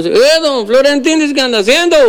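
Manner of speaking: high-pitched rural healer greeting a neighbour; old healer voice. Acted.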